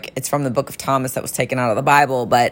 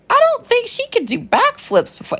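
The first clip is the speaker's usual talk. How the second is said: incredulous inner thought, pitched way up; confused disbelief. Retold or imitated. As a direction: squeak it out in baffled disbelief. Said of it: "I was confused 'cause I'm like".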